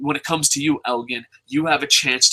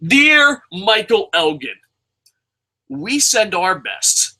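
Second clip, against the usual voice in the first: slow, raised, declaimed salutation; open letter; 5 semitones higher, 3 dB louder, slower than usual. Acted. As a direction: proclaim it like an open letter, drawing out the words. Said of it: "open letter to Elgin".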